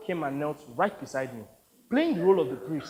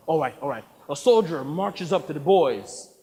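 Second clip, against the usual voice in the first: normal talking voice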